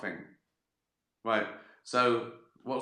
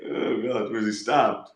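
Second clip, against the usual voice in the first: chuckling detective; 3 dB louder than usual. Pretend. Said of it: "But I'm sort of chuckling".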